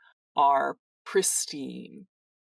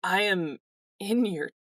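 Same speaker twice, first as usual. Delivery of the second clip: put-on awkwardly grateful young man; 4 semitones higher than usual. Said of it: "kind of awkwardly".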